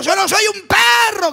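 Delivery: slow, high full-voiced shout; drawn-out bellow of outrage; bellowing Goliath. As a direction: roar it out as an enraged giant. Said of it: "venía arremetiendo y vociferando".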